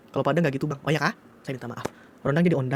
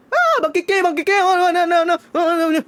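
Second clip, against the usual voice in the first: panicked cries; 13 semitones higher, 5 dB louder, slower than usual. Retold or imitated. Mother panicking as dogs attack.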